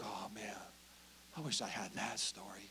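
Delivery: breathy envious whisper. Retold or imitated. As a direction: whisper it wistfully.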